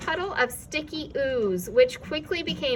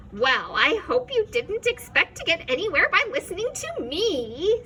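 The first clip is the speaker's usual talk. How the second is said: gleeful; 7 semitones higher, 4 dB louder than usual. A narrator's reading. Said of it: "said the voice gleefully".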